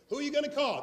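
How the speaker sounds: high, loud rallying question; call-out line. Shown as a demonstration. Speaker prompting the audience with a high, loud rallying question. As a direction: pitch it up like a chant leader. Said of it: "Just say it like you mean it".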